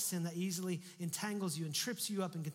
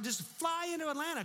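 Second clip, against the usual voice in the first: incredulous; 10 semitones higher than usual. Retold or imitated. Incredulous at plane circling.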